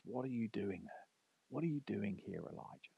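God's question, fading into a whisper. Acted. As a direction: breathe the words very softly. God asks again, whispering.

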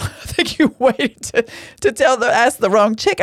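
amused high retort with a chuckle; half-laughing self-quote. Retold or imitated. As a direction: laugh through the joke. Retelling her own joking reply.

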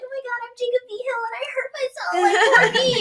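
high whiny mimicry; mocking mimic voice. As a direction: whine it to mock him. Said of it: "mimic him".

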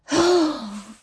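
a fed-up huffing sigh; exasperated huff. A narrator's reading. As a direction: blow out air, annoyed at the request. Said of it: "Chase glares and huffs".

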